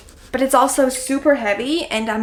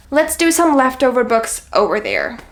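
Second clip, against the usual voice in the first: ordinary talk, nothing marked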